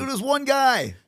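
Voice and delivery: retold exasperation. Host replaying a reply to Austin, exasperated. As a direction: let the pitch leap in mock disbelief.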